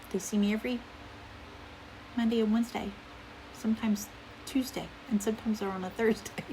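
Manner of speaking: ordinary talk, nothing marked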